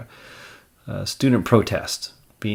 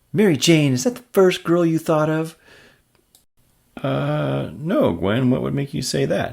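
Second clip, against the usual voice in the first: higher, pointed comic-book read; jealous girlfriend; 7 semitones higher, 5 dB louder than usual. Acted. Gwen needling Peter, then Peter squirming.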